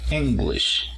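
English with a strong Eng; stress on the first syllable. Shown as a demonstration. Teacher models the nationality, first syllable strong. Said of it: "En la primera sílaba".

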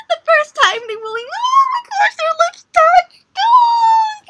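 fangirl squeal. Real, not acted. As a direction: lose it completely over the kiss. Fan freaking out over kiss.

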